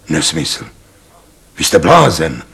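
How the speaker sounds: dismissive rumble. Acted. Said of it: "hučel pan doktor Link".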